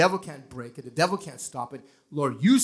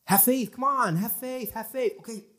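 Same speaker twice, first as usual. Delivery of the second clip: higher, urgent mock pep talk; mock self-coaching; 7 semitones higher than usual. Preacher imitates people pumping up faith, higher and urgent. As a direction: push yourself to have faith. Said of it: "That's how most people work".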